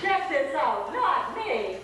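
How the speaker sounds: loud, comic balking; hammed-up protest; mock refusal. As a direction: push the job away emphatically. Acting out refusal of God's call.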